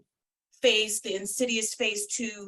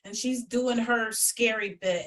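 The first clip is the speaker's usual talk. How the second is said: ordinary delivery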